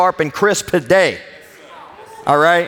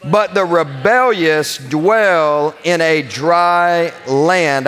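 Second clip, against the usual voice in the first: ordinary delivery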